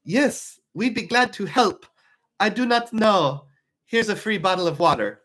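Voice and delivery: put-on Swedish accent. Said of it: "that's my Swedish accent".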